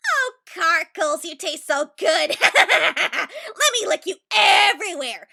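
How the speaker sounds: slow, loud hammed-up seduction; mock seductive. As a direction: drawl it out like a lovesick flirt. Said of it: "So I was all".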